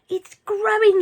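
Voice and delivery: high, loud excited yell. A narrator's reading. George yelling up to Grandma, excited. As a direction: shout in delight. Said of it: "he yelled".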